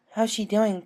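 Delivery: concerned bookish pony; Twilight voice. Acted.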